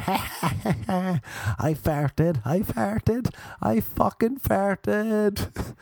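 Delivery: high, giggly mock boasting; high-pitched gloating through laughter; gleeful dopey friend. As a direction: imitate a dopey kid delighted with himself, stretching the last line. Retold drunk-like friend gloating, laughing.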